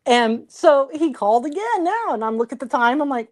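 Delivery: usual speaking voice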